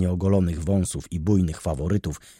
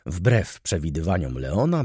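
ordinary delivery, nothing marked

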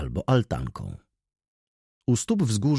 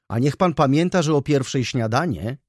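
higher, louder parting call; raised, insistent reminder; 6 semitones higher, 3 dB louder than usual. A narrator's reading. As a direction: call a reminder after someone. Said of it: "zawołała panna Felicja".

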